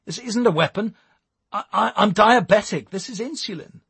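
high, panicked stammering protest; panicking. A narrator's reading. Eric explaining the needle to armed police, panicking. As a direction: protest frantically, voice pushed up high.

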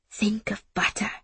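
soft, breathless whispered self-calming; desperate whisper. Acted. Ada whispering to herself through a dizzy spell. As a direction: whisper to yourself to stay upright. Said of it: "I told myself desperately".

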